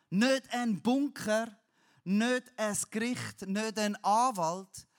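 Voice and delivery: slow, loud hammering on each nicht; emphatic negation. Retold or imitated. David rejecting every false refuge, emphatic and slow. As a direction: punch every nicht hard.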